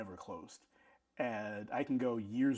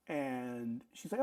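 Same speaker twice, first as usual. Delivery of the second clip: usual speaking voice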